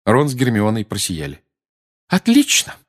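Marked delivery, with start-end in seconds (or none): 2.1-2.8 high, loud cry; angry mother shouting; shouted. Acted. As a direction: snap it out. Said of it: "крикнула миссис Уизли".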